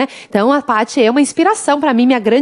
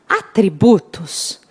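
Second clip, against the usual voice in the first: "Atributos" drawn out slowly, lower and weighty; slow stressed keyword; 5 semitones lower, 3 dB quieter, much slower than usual. Shown as a demonstration. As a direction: spell out "Atributos" slowly, syllable by syllable, like a heading.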